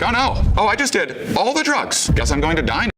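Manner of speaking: high-pitched mock panic; comic high wail of doom; retold panic. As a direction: re-enact a drug freak-out, voice shooting up. Retold panic as universe collapses.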